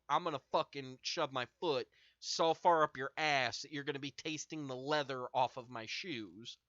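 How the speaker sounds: ordinary talk, nothing marked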